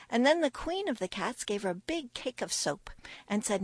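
plain reading voice

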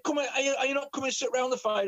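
high, fast pleading woman's voice; coaxing call. Retold or imitated. Coaxing him to join fire.

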